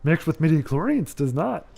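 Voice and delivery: playful Yoda-style voice; Yoda-like impression. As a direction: imitate Yoda, pitch jumping on the hums. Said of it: "that is what he would say".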